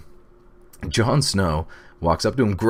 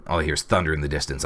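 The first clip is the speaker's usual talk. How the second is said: usual speaking voice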